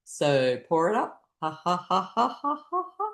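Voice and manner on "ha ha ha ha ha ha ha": measured ha-ha, climbing; deliberate exercise laugh; laughter. Shown as a demonstration. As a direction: laugh in even pouring beats. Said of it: "it's called the milkshake laughter".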